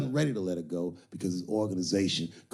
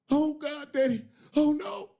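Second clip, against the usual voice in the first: much higher, louder hammed-up wailing; mock panic; 3 dB louder than usual. Woman panicking to the pimp over the dead man, shrill mock wail. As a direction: squeal in panic, way up high. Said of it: "woman panics over corpse".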